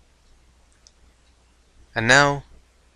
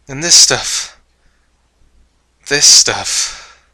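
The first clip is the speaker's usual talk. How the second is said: hammy character voice; 3 semitones higher, 8 dB louder, slower than usual. Acted. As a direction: ham it up like a cartoon villain. Character addresses listeners, over the top.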